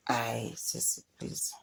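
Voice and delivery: whispered plea; a hushed aside to the viewers. Real, not acted. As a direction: breathe it out as a whisper. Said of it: "whispered aside to the cast".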